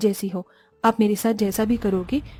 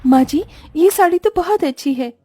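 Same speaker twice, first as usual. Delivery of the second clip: higher, louder delight; delighted bride; 6 semitones higher, 5 dB louder than usual. Acted. Daughter-in-law thanks mother-in-law for the saree, delighted. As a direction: gush over the gift.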